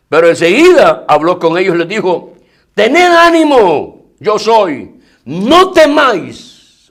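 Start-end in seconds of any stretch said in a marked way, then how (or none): 2.7-6.9 slow, high, ringing words of comfort; Jesus calming them. Acted. Jesus to his frightened apostles, slow and commanding comfort. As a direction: lift each word slowly over the storm.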